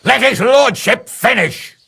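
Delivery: high, sharp scolding outburst; angry rebuke. Acted. Noble rebukes the buffoon for interrupting, angry. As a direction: berate him for interrupting. Lord scolds a fool in hall.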